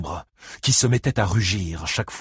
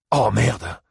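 startled curse, a bit raised; exclaiming; 3 semitones higher, 4 dB louder than usual. A narrator's reading. Visitor noticing the sandwich in his hand, exclaiming. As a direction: blurt it out in dismay. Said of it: "s'exclama Buddy Lee".

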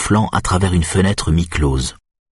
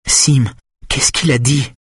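slow, higher woman's voice; Simone, insistent; 7 semitones higher than usual. Acted. Simone asks Schulman what was said, slow insistent voice. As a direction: press a barely conscious patient, slowly.

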